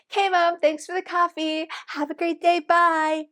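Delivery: put-on high, breezy kid's goodbye; totally fine daughter. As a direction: chirp a carefree goodbye to Mom. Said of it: "And she's totally fine".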